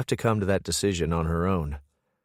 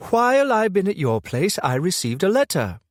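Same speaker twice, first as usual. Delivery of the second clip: female voice; 12 semitones higher, 5 dB louder than usual. A narrator's reading. Brooke's accusing voice.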